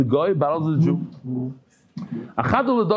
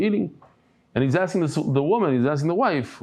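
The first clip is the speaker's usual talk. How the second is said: normal talking voice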